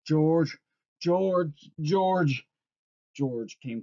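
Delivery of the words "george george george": high-pitched, plaintive calls; repeated anguished cry of a name. A narrator's reading. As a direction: call out for your friend in distress. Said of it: "And he cried".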